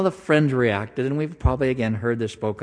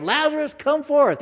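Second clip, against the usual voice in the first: high commanding call into the tomb. Acted. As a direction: summon the dead man out. Jesus calling Lazarus from grave.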